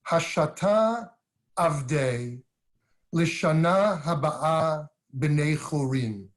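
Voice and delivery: "Hashata Avdei, Lishana Haba'a B'nei Chorin" recited slowly in Aramaic; Aramaic recitation. Shown as a demonstration. Rabbi recites the Seder words to the cardinal, slow Aramaic recitation. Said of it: "we say in the ancient Aramaic".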